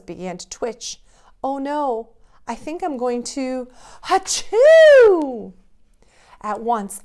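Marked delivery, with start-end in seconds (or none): 1.4-5.5 rising into a loud hachoo; Father Christmas can't hold back a sneeze; building to a sneeze. Acted. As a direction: let the sneeze build then burst.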